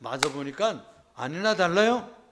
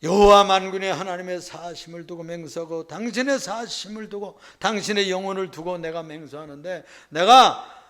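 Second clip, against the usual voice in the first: fervent oath; 4 semitones higher, 11 dB louder than usual. Acted. Mother swearing oath to prophet.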